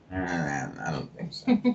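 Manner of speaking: low, soft, offhand brush-off; dismissive refusal. Retold or imitated. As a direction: quietly wave the idea away. Dismissing meditation, retold.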